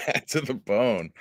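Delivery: amused slow laugh through words. Real, not acted. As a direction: chuckle as you say it. Repeating song title amused.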